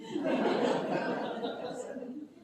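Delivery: breathy loud burst of laughter; real audible laugh. Real, not acted. Laughing at her own story.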